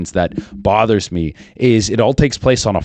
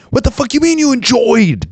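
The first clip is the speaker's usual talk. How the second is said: very high, squealing mock outrage; incredulous outburst; 4 dB louder than usual. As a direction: squawk in disbelief at the claim. Mock outrage at chatbot claiming enjoyment.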